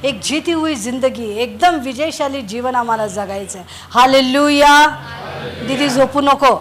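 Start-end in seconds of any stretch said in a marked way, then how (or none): 3.9-6.6 call to the congregation. Real, not acted. Preacher calls to congregation.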